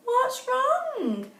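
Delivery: squeaky concerned question; high-pitched friendly worry; high cartoon voice. Kitty voiced high in story.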